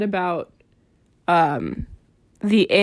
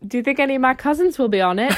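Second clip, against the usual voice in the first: quick, higher Irish lilt; attempted Irish accent; 5 semitones higher, much faster than usual. Cousins joke to co-host, rough Irish accent. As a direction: have a go at an unpractised Irish accent. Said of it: "I need to work on my Irish accent".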